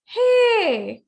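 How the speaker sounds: loud, high drawn-out hey; high pitch. Shown as a demonstration. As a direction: call it out high, then let it drop. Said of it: "as high as you can".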